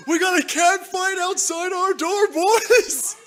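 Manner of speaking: high, loud fight announcer yell; loud, high-pitched excited outburst; excited shout. Real, not acted. Announcing a cat fight outside.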